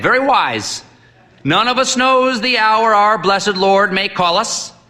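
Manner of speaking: put-on high, booming old priest; hearty old priest. A narrator's reading. Old priest sizing up the sick boy, hearty. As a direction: boom at the boy like a brisk priest.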